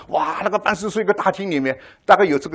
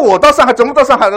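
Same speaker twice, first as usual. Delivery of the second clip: mock boast; 8 semitones higher, 10 dB louder than usual. Retells man boasting loudly.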